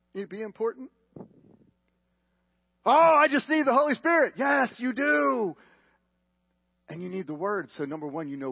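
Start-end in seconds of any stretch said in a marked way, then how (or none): 2.8-4.3 high, loud, exaggerated protest; mock objection. Preacher mimicking someone who skips the Word, mock earnest. 4.4-5.6 high, drawn-out loud yes; emphatic agreement. Real, not acted. Preacher answering the objector he just mimicked, emphatic.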